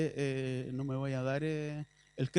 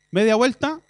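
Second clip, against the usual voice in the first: loud barked order, higher; military command; 5 semitones higher, 9 dB louder than usual. Retold or imitated. Preacher explaining repentance shouts like a commander. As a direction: shout it like a drill sergeant. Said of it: "palabra militar".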